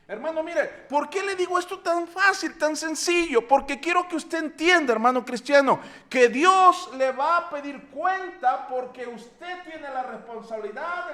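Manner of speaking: rising to a full preaching voice; fiery preaching. Acted. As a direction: exhort the brothers from the pulpit. Preaching to the congregation.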